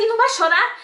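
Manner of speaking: put-on high, loud worried question about the baby; anxious high question. Mother fearing her newborn won't cry, anxious high question. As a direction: ask it in a panic, voice up high.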